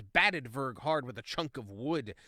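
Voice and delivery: ordinary delivery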